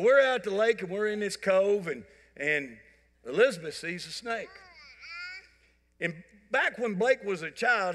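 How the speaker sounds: usual speaking voice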